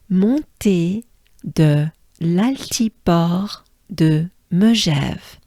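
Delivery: "Montée de l'altiport de Megève" slow, piece by piece; broken down slowly. Shown as a demonstration. Teacher repeats it once again for learners, slowly broken down. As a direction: separate each part of "Montée de l'altiport de Megève" with a pause. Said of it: "it's easy if we break it down".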